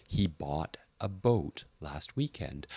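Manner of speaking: slow, careful reading of the sentence; slow model sentence. Shown as a demonstration. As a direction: say it slowly, feeling each vowel. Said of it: "So when you say".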